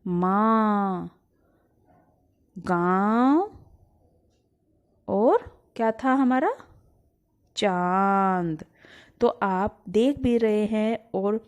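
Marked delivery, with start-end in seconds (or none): 0.1-1.2 माँ very slowly, vowel nasalised through nose and mouth; nasal demo word. Shown as a demonstration. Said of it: "आप बोल के देखो जरा". 2.6-3.7 गांव slowly with nasal vowel and rising tune; nasal demo word. Shown as a demonstration. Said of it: "आप बोल के देखो जरा". 7.6-8.7 चांद slowly, nasal आ held long; nasal demo word. Shown as a demonstration. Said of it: "आप बोल के देखो जरा".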